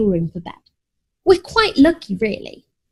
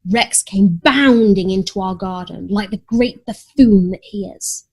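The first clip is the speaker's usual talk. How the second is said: plain reading voice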